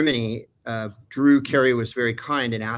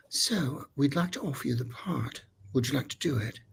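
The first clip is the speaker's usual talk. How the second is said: put-on softer, polite English accent; a hint of a polite English producer; calm English lady; 3 semitones higher, 8 dB quieter than usual. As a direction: offer the part gently in English tones. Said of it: "in her very calm English way".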